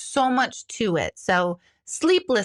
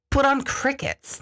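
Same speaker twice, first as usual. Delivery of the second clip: ordinary talk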